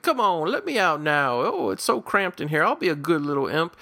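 high, pleading little creature voice; silly imp voice. Retold or imitated. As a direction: whine like a trapped little imp. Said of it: "and the imp being like".

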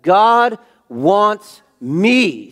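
"God wants me" loud, high, every word stretched; emphatic. Shown as a demonstration. Preacher making congregation emphasize "God wants me", shouted word by word. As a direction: punch each word of "God wants me" slowly. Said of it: "Emphasize it".

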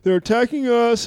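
put-on aggrieved protest; mock complaint. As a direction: draw it out like a mocked complainer. Mocking complainers' retort.

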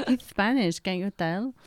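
usual speaking voice

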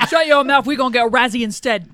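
hammed-up British telling-off; mock British accent. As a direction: scold in put-on British. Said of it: "You guys all sound like".

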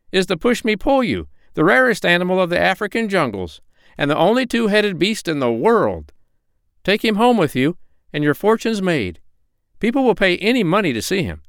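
high-pitched, emphatic monkey showman; proud monkey. A narrator's reading. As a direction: present the rare beast high and proudly. Voices Chee-Chee the monkey.

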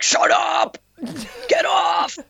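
imitating an enraged heckler's high strained shout; mock a furious wedding guest yelling. Wedding guest heckling the dance teacher, enraged shout. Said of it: "filled him with rage that he couldn't contain".

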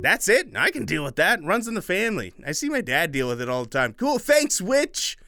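put-on kid voice. Boy relieved.

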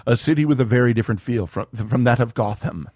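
ordinary talk, nothing marked

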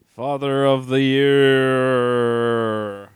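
slow, loud drawn-out sarcasm; sarcastic. Real, not acted. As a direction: drag it out mockingly. Sarcastic quip.

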